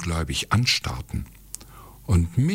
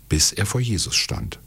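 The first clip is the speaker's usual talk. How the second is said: plain reading voice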